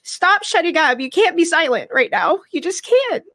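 high, crying but insistent; impassioned appeal, voice pushed up by crying; tearful urging. Real, not acted. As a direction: plead with them through real tears. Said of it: "'Cause I'm crying".

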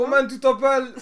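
playfully ape a Frenchman chatting someone up; mock French. Mocking the French guest.

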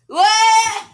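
high, loud shriek of disbelief. Pretend.

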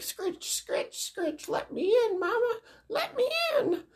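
tiny, very high creature squeal; scarecrow voices. Retold or imitated. Scarecrows at mama's window, extremely high squeaky pleading. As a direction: squeak and scratch like monsters begging entry. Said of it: "two scarecrows like".

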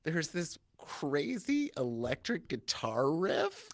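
usual speaking voice

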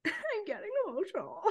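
high, laughing echo of a choked-up friend; mock emotional. Cohost teasing partner on their anniversary, laughing mock-emotional. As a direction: playfully wail it through a laugh.

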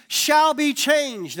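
loud, high and very slow; drawn-out emphasis. Acted. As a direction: stretch every word out. Quoting scripture emphatically.

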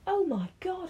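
high thrilled gasp; pleased surprised squeal; excited. Real, not acted. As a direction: burst out in delight. Thrilled it worked.

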